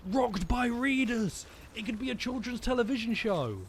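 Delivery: high, slow title-card shout; hammed-up TV show pitch; excited announcer. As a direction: announce it like a cheesy kids' show host. Hyping a made-up TV show.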